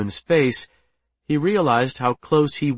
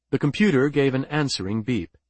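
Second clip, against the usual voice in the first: ordinary delivery, nothing marked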